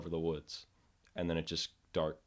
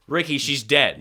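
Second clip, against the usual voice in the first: mock urgent call, louder and higher; playing Ronnie; 7 semitones higher, 10 dB louder, slower than usual. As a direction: tell him she's gone. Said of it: "acting out the scene".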